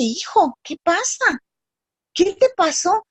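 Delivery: retold motherly alarm, pitch lifted; concerned mother. Mother asks the lost boy what happened, concerned.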